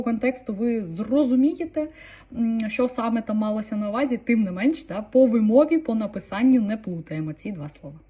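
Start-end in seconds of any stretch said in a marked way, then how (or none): none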